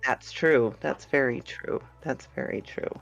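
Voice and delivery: ordinary talk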